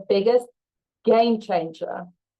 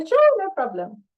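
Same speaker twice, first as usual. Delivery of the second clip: cheerful reply; 11 semitones higher than usual. Retold or imitated. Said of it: "And go".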